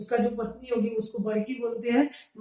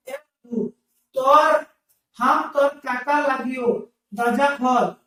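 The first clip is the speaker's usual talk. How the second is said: calling out; 6 dB louder, slower than usual. Retold or imitated. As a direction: shout for Raghu through the door. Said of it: "बुलाने लगते हैं।".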